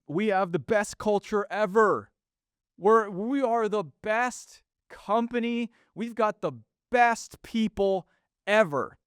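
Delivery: high, slowed, smug bragging; mock corporate boast. Podcaster mocking company culture posts, high smug boast. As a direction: ham up the best-company line. Parodying corporate self-praise.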